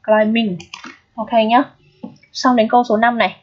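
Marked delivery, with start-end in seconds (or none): 0.1-0.6 "Climbing" with just an M sound, klai-ming; silent B. Shown as a demonstration. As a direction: say "Climbing" leaving the B unspoken. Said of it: "mà chỉ đọc âm M mà thôi". (đọc "Climbing" là klai-ming, âm B câm)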